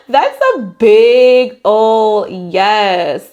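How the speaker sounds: loud, slow, drawn-out big yes; delighted yes. Real, not acted. Tarot reader telling viewers the Sun card means yes, delighted and loud. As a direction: savour the good news out loud.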